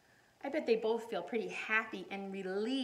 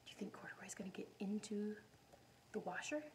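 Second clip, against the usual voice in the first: hushed whisper; 11 dB quieter, faster than usual. Real, not acted. Reader whispering an aside to the children. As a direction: lean in and whisper it.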